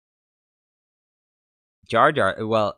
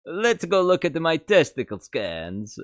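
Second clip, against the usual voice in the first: much higher, slow alien trader; Watto voice; 9 semitones higher, 5 dB louder than usual. Acted. Streamer joking with Watto's line, much higher and slow. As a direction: savour the silly line as Watto.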